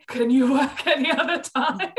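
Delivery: a joking jab, laughing; laughing question. Real, not acted. Joking about work hours.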